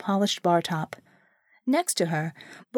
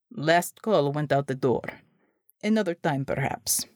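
put-on Russian accent; 6 semitones lower than usual. Severe Russian Unter speaks.

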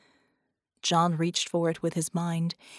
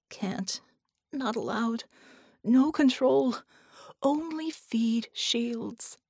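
halting, broken creature voice, slowing at the end; a suffering ship mind forcing out words; strained alien ship voice; 4 semitones higher, slower than usual. A narrator's reading. Dying ship mind answering them in broken strained words. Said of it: "A violent shudder ran through".

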